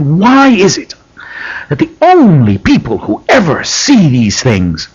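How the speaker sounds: high opening, deliberate pointed question; Michael Jackson, pointed. Retold or imitated. Retelling the host's question to Sagan, pointed. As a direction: imitate a pointed interviewer, leaning on key words. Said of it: "quite pointedly".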